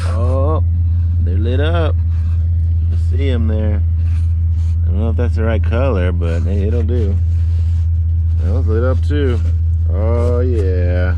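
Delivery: delighted shout as the lights come on; excited. Real, not acted. Fog lights finally turn on.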